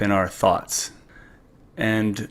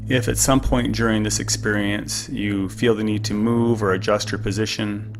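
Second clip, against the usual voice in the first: calm; 4 dB quieter than usual. Acted. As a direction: gently guide the listener into stillness. Leads a guided breathing meditation.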